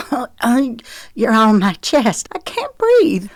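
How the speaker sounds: high, pinched squeak of a squashed woman. Acted. Pinned under the man, she squeaks high. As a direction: squeak it out with no air left under him. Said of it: "she squeaked".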